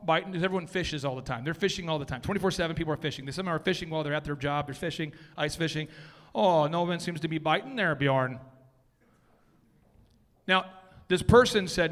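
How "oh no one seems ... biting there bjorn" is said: put-on Minnesota accent with round vowels; hammed-up Minnesotan. Presenter as an ice fisher lamenting to Bjorn, Minnesota accent. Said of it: "everyone in Minnesota is Bjorn".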